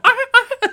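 very high, loud clown laugh; creepy clown cackle. Retold or imitated. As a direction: cackle like a clown squirting your face. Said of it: "and then go".

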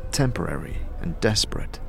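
usual speaking voice

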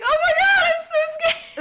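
high squeaky mock panic; imitating scared friend. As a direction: squeal it like a frightened girl. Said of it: "And she was like".

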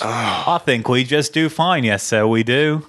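a slow put-on British accent; lightly hammed-up English accent; proud mock British accent; slow fake British accent. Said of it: "do British accents".

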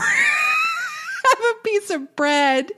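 very high, slow, laughing jeer; hammed-up snooty bread line; condescending mock. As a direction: draw it out in a high sneer. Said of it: "she says to Lisa".

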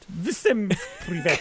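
put-on hammy Russian accent, slowed down; fake Russian accent. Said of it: "that's a fake, uh, Russian accent here".

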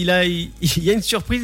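normal talking voice

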